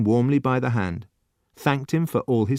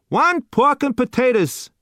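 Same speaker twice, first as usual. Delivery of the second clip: much higher, louder, slow call to the kitchen; shouted order; 5 dB louder than usual. A narrator's reading. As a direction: bellow the order to the back. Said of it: "the waiter shouted".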